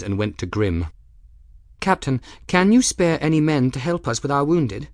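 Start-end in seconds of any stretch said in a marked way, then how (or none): 1.8-4.9 higher woman's voice, direct request; a lightly raised voice asking the captain; Gwen asking. A narrator's reading. Gwen asks Grimm for help.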